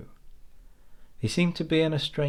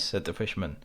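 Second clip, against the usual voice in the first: usual speaking voice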